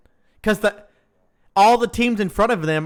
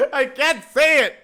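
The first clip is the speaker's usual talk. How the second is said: helpless laughter; 9 semitones higher than usual. Real, not acted. Streamer failing at a driver's name, helplessly laughing. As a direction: laugh so hard the words barely come. Cracking up at own failed attempt.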